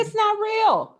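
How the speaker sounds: high, slow, hammered insistence; emphatic insistence. Real, not acted. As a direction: lean hard on every word, slowly. Insisting imposter syndrome isn't real.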